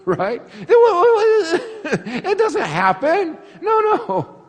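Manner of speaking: high laughing protest, drawn out slow; can barely get it out for laughing. Real, not acted. Preacher laughing as he dismisses the idea to the congregation. Laughing at his own joke.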